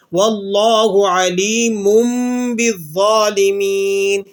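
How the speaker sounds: slow chant, علیم closing on a long hummed "mumm"; tajweed iqlab recitation. Shown as a demonstration. Teacher presenting the double-pesh example, tajweed iqlab recitation. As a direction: stretch the vowels, tanween of علیم as m before بالظالمین. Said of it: "میم کے ساتھ تبدیل کر کے پڑھنا ہے".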